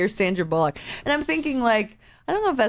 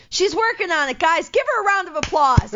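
driver announcing to tourists; 9 semitones higher than usual. Retold or imitated. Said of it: "He turns to all the Asians and he's like".

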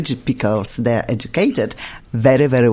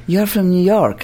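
normal talking voice

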